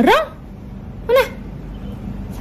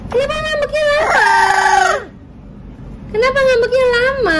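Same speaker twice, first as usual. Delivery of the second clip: high coaxing pet talk; 10 semitones higher than usual. Acted. Coaxing a sulking pet bird.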